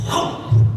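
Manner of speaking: loud, sudden mock blow noise; imitated punch whoosh. Big guy clubs the little guy, loud imitated blow. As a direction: make the sound of a clobbering.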